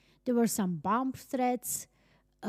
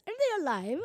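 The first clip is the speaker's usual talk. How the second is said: offhand, bored imitation of the officer; nonchalant; 6 semitones higher, faster than usual. Mimicking the police officer's casual question, nonchalant. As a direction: toss it off like it's routine. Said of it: "with such a nonchalant voice".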